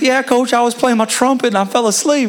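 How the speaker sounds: put-on high, lightly Southern kid voice; Southern accent. Freshman telling coach about his trumpet, Southern accent. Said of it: "he had a Southern accent".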